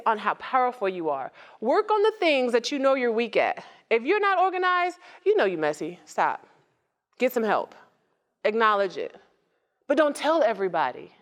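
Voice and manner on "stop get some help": ordinary talk, nothing marked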